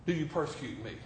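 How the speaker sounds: dropping to a soft, low question; hushed rebuke. Acted. Voice from heaven questioning Saul, sudden soft hush. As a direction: pull it down to near silence.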